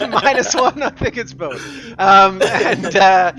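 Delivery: talking through real laughter, high and loud; cracking up mid-sentence; laughing. Real, not acted. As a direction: giggle between the words. Game master cracks up at roll.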